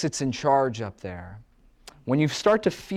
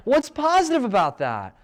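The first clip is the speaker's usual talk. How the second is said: teasing; 6 semitones higher than usual. Retold or imitated. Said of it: "I'm like".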